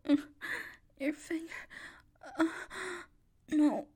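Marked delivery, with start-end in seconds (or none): none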